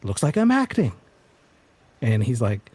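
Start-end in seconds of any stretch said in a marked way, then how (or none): 0.0-0.9 higher, whiny diva tantrum; fed-up starlet throwing a fit; actress complaining. Acted. Actress storming off set, high whiny complaint. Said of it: "she's like".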